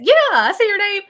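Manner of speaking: high, loud, cheery re-enactment; retold eager reply. Host re-enacting her answer to Brenda, eager and loud. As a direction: call it out like an enthusiastic yes.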